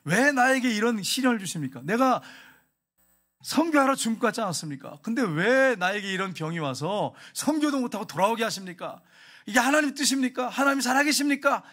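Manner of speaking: mock an aggrieved believer challenging God; resentful complaint. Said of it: "이렇게 원망을 했다면".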